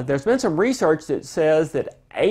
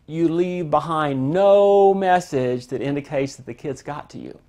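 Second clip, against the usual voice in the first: usual speaking voice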